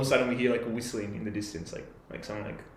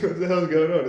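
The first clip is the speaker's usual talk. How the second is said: raised, louder startled question; 6 semitones higher, 7 dB louder than usual. Retold or imitated. Campers asking their screaming friend, startled. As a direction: react to a sudden scream.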